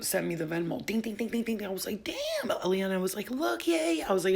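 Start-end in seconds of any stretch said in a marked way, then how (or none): 2.0-2.5 high-pitched stunned damn; mock-stunned reaction to the money; amazed damn. Retold reaction to sales pinging. 3.3-4.0 high, louder happy cheer; imitating a friend's excited cheer; cheering yay. Quoting Eliana cheering.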